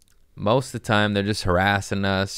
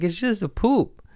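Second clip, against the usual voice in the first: mock high reassurance; 13 semitones higher than usual. Dad calling to daughter.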